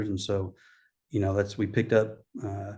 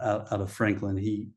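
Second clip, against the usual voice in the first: ordinary talk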